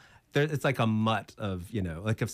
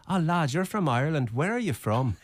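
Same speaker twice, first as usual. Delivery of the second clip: put-on higher, lilting Irish accent; Irish bartender; 6 semitones higher than usual. Bartender leaning in to ask, Irish accent. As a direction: imitate an Irishman greeting a countryman. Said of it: "Like, he was Irish".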